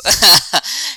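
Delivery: high, loud laugh bursting out; laughing at a favourite scene; burst of laughter. Real, not acted. Fan laughing at a scene he predicted, loud laughter. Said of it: "so I'm probably gonna laugh".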